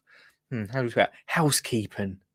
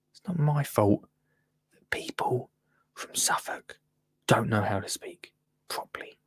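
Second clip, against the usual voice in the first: near-whisper, much softer; muttered aside; 4 semitones lower, 9 dB quieter than usual. Real, not acted. Host defending his pronunciation to viewers, muttered aside. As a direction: drop to a whispered dig under your breath. Muttered jibe at Suffolk people.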